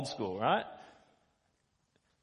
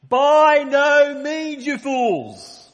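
By no means shouted long and high, you fools still loud; emphatic shout; 12 semitones higher, 15 dB louder, much slower than usual. Retold or imitated. Preacher voicing Paul shouting down the foolish argument. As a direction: bellow it like Paul rebuking fools. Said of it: "He is emphasizing as much as he can".

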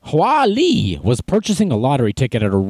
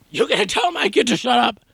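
high-pitched imitation of an irate dad; hammed-up yelling parent; mock angry yell; 11 semitones higher than usual. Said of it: "He must be really mad".

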